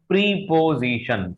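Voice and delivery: preposition said slowly as "PREE-po-zi-shun"; high, drawn-out "pree" opening preposition; wrong pronunciation. Shown as a demonstration. Teacher testing whether this is right, deliberately mispronounced. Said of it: "ये इसका सही pronunciation नहीं है".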